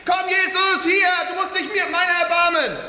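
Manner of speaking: high, slow, loud desperate cries. Acted. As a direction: shout over the whole crowd. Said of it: "schrie nur umso lauter".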